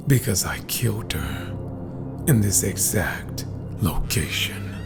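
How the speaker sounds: ordinary delivery, nothing marked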